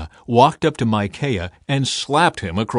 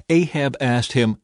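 ordinary delivery

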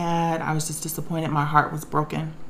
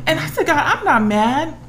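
high, tearful plea; getting tearful; 9 semitones higher than usual. Real, not acted. Woman recounting her words to God, getting tearful. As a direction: tell God earnestly, near tears. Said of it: "I'm getting tearful about it".

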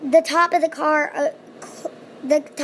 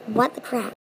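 low, softer mutter; muttered quote; 6 semitones lower, 5 dB quieter than usual. Retold or imitated. The father reacting to the skeleton, muttered. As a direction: mutter it under your breath. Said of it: "He didn't say it, but he was like".

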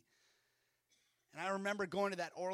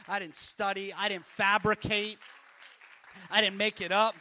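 building emphatic declaration; 2 semitones higher, 11 dB louder than usual. Acted.

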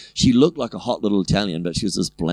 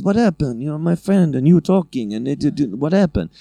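put-on higher, agitated Italian-accented broken English; 7 semitones higher than usual. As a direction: imitate a puzzled Italian woman demanding answers. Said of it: "the Italian chick came back".

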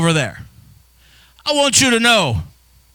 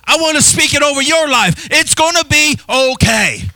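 fervent declaration to the congregation; preaching at full force; 5 semitones higher than usual. Acted. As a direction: proclaim it over the crowd. Preaching a proclamation.

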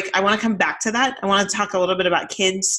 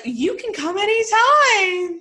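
a much higher, slow, tearful-sounding "you can come anytime"; high gushing; 13 semitones higher, 3 dB louder, slower than usual. Retold or imitated. Mom gushing to polite visiting boys, high and slow. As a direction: gush at the boys as if moved to tears.

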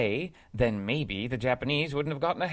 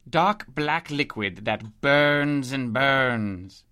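put-on very slow, louder, word by word as to a child; slow explanation; 4 dB louder, much slower than usual. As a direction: spell it out patiently but firmly. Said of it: "explaining oil slowly".

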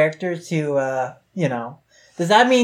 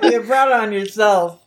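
laughing tease; 3 semitones higher than usual. Real, not acted. Co-host teasing the upset reader, laughing. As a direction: talk through real laughter.

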